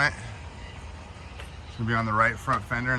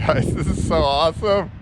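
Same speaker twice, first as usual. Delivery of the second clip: laughing with delight; laughing excitedly; 6 semitones higher, 6 dB louder than usual. Real, not acted. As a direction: laugh through the words, thrilled. Thrilled car delivery moment.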